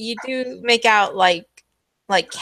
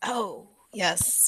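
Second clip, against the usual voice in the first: conspiratorial whisper; 11 dB quieter than usual. Acted. GM voices man, whispering reply.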